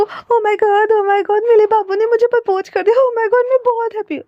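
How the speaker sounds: very high, excited squeal; jump-up-and-down squeal of joy; squealing excited girl. A narrator's reading. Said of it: "वो लड़की ना चिल्ला रही होती है". (बहुत ऊँची आवाज़ में खुशी से चीखती लड़की)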